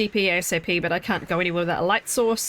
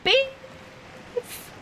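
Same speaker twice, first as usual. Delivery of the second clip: excited squeal; 3 dB quieter than usual. Real, not acted. Greeting a raider excitedly.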